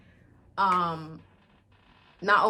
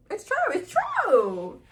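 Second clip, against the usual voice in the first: high, loud, drawn-out agreement; excited squeal; 13 semitones higher, 6 dB louder, slower than usual. Real, not acted. Fan agreeing he is a real one, excited high squeal. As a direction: squeal it high, stretching each "true". Gushing over romance.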